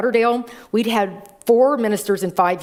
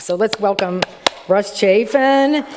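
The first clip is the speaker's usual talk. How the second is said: announcer welcome. Real, not acted. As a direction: call him up like a host. Welcoming speaker on stage.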